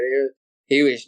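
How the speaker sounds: usual speaking voice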